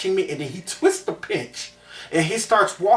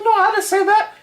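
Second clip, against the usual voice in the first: put-on much higher, louder frightened child's denial; scared denial; 7 dB louder than usual. Boy denying the teacher's accusation, high and scared. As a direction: squeak it out like a terrified kid. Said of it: "I'm like".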